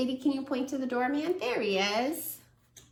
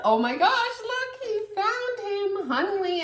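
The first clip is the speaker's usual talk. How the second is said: excited discovery; 11 semitones higher than usual. Real, not acted. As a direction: point it out with big delight. Excited discovery reading to child.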